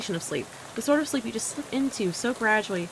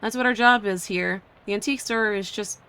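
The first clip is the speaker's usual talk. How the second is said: ordinary delivery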